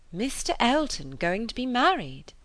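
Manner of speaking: mildly astonished query; stunned question. A narrator's reading. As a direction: take it in with surprise. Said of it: "as soon as she could speak".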